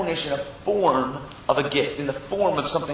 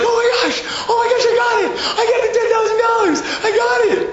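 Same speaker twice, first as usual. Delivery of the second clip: very high, loud imitation of a winner's screams; hammed-up, ecstatic screaming; mock screaming; 6 dB louder than usual. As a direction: shriek it like a jackpot winner. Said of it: "he started screaming".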